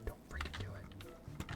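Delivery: soft, unvoiced pleading whisper; tense whisper. Real, not acted. Player whispering at a game worker, tense. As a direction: hiss it under the breath.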